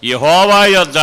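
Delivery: loud, fervent scripture repeat; preaching at full force. Acted. Preacher echoing the psalm reading to the congregation, loud and fervent. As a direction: proclaim the verse back with fervour.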